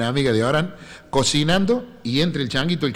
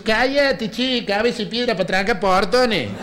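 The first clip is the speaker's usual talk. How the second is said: higher, snappy comeback; fierce woman retort; 6 semitones higher, slower than usual. Retold or imitated. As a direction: bite back at the catcaller. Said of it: "se da vuelta y dice". (la más fiera le contesta al que la piropea, voz más aguda)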